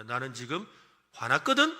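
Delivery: playfully angry rising; mock-angry announcement. Shown as a demonstration. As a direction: snap it out like you're mad. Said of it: "해볼까요?".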